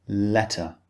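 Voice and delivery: "letter" with a clean, distinct t; "letter", no d sound, crisp t; British T. Shown as a demonstration. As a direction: pronounce the t of "letter" clearly, British style. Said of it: "the T is clearly pronounced".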